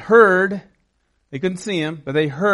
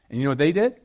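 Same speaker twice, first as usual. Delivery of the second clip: ordinary delivery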